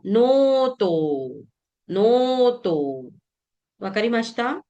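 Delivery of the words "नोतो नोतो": नोतो stretched very slowly with long vowels; teacher modelling नोतो with long vowels; very slow demo. Shown as a demonstration. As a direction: draw नोतो out syllable by syllable. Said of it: "hyphen लगा".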